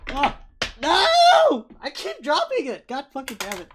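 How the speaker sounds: high, loud frustrated yelling; yelled no, then high-pitched cursing; exasperated outburst. Real, not acted. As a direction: cry out in exasperation. Game master keeps dropping something.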